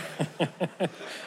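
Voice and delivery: quick unvoiced chuckle; soft real laugh; breathy laugh. Real, not acted. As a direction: laugh off his own coffee joke. Laughing at own joke.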